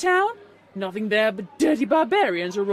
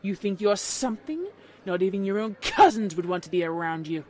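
loud, lower-pitched haughty put-down; snobbish contempt; 4 semitones lower, 6 dB louder than usual. A narrator's reading. Snobbish unicorn insults Applejack.

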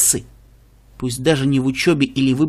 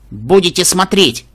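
stern; 3 semitones higher, 4 dB louder than usual. Acted. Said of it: "строгий голос Бэйнфайр".

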